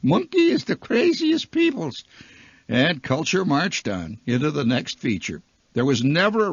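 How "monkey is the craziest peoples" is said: very high, comic Bavarian voice; rich Bavarian accent. Acted. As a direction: squeal it in a cartoonish Bavarian accent. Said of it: "with a rich Bavarian accent".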